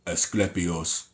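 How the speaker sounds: very slow, low, soft voice; imitating game character. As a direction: draw out Asclepios in a deep, hushed impression. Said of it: "that's how he would say it".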